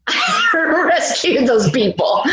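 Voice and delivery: slow, laughing brag. Real, not acted. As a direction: chuckle through it. Laughing, proud.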